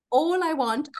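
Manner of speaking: ordinary talk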